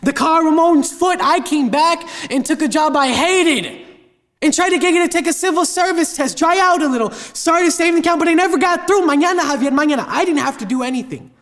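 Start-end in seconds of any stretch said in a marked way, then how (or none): 0.0-10.1 higher-pitched, mounting frustration; exasperated tirade. Acted. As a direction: list every grievance at your father. Acted quarrel with father.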